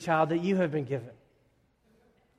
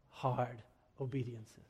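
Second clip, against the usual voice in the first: much softer and slower; hushed; 3 semitones lower, 11 dB quieter than usual. Real, not acted. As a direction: drop to a grave hush. Sermon turn to hard topic.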